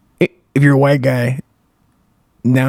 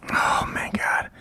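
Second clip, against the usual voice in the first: whispered aside; 12 dB quieter, slower than usual. Real, not acted. Muttered aside to himself.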